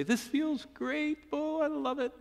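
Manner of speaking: very high, slow mock-delighted voice. Preacher voicing what Job didn't say, mock delight. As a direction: put on absurdly high, fake enjoyment of suffering. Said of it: "Job didn't say".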